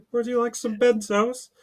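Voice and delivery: high-pitched, British-sounding impression; botched impression that comes out British; British accent. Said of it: "He just hears British".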